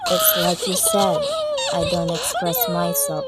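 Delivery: a tearful confession. Acted. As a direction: admit it through tears. Emotional moment, voice breaks.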